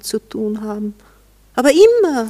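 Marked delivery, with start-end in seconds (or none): 1.5-2.3 jealous jab. Acted. Jealous jab at his women.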